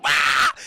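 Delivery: loud screech; loud attacking screech; creature screech. Acted. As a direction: screech like a demonic doll.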